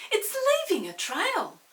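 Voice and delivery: high loud eager exclamation; excited children. Acted. Children noticing the snail's trail, high excited voice. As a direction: point it out like thrilled kids. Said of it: "say the children".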